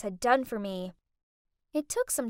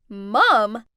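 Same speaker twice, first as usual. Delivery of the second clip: loud, disbelieving cry; shocked shout; 4 semitones lower, 10 dB louder than usual. Acted. The rich kids shocked that the maid is her mom, loud shout. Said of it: "Jasmine, Brent, and Sophia all shouted".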